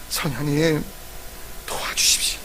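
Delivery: soft and breathy, almost unvoiced; whispered plea. Shown as a demonstration. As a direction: pray it in a hush, exhausted. Demonstrated prayer for help. (성령님께 속삭이는 간절한 기도)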